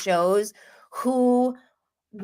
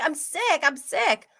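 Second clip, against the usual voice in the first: high mock-pleading protest; imitated protest; 7 semitones higher than usual. Sick girlfriend retold answering the man on top of her, mock protest.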